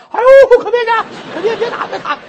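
high, loud terrified pleading; panicked begging. Acted. Terrified nun begs the magistrate not to beat her, high shrieks. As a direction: cry out to stop the beating.

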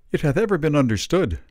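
a hint of a hearty burly man; lightly voiced Jonson. A narrator's reading. Ben Jonson begins addressing the shop, hearty. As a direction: start the pronouncement.

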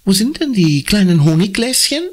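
high, lightly voiced mother; mother's voice. A narrator's reading. Mama fragt beim Frühstück nach den Honiggläschen, hoher Mutterton. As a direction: ask it as the mom at the table.

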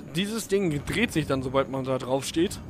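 ordinary talk, nothing marked

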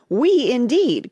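loud, high, drawn-out outrage; indignant cry. A narrator's reading. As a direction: cry it out as an affronted mouse. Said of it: "cried the mouse, who was trembling".

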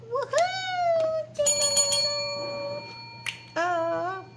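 very high, loud whoop and sing-song dings; excited cheer. Real, not acted. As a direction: celebrate like you just won. Celebrating a filled envelope.

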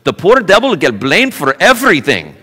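put-on lilting, tongue-in-cheek Indian accent; playful mock Indian accent; heavy Indian accent. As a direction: imitate an Indian preacher's heavy accent, pitch swooping. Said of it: "he's got this really heavy accent".

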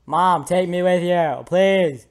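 put-on high, loud child's plea; hammed-up desperate plea; acted pleading. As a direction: beg mother loudly, in character. Voicing child pleading with mother.

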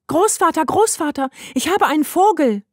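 viel höher, lauter Ruf; stürm herein und ruf begeistert; aufgeregt rufen. A narrator's reading. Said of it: "rief sie aufgeregt". (Heidi ruft aufgeregt nach dem Großvater)

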